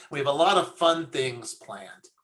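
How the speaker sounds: ordinary talk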